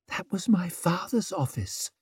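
soft, high hushed whisper. A narrator's reading. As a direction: whisper it sadly in passing. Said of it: "whispered Savine".

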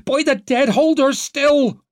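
high, loud, commanding cry; urgent shout. A narrator's reading. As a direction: bark the order to hold her down. A man shouts orders over her.

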